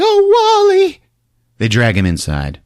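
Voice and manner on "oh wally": very high, louder alarmed cry; high squealed cry. Retold or imitated. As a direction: squeal his name in alarm. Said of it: "Cordelia's like".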